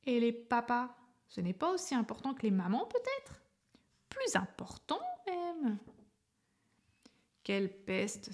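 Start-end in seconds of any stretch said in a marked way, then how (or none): 0.0-5.8 teasing voice climbing high to a sly question; a cheeky letter needling everyone, high and sly; provocative taunt. Acted. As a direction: stir up trouble like a little pest. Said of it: "Il roulait des yeux terribles".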